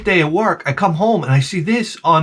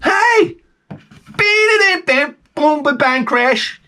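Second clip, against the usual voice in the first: mock drum sounds; 11 semitones higher, slower than usual. Mimicking drum beat sounds.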